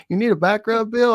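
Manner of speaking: high, eager offer; butter him up, grinning; high suck-up voice. Pretend. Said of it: "I'd be making him stuff".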